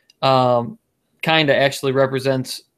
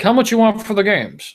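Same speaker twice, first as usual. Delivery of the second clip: put-on kid asking voice; 9 semitones higher than usual. Kid voiced asking at yard sale.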